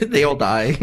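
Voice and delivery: loud, laughing blurt; laughing joke. Real, not acted. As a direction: crack up at your own spoiler. Joking spoiler with laughter.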